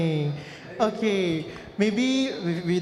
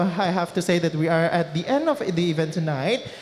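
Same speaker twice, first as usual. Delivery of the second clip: ordinary talk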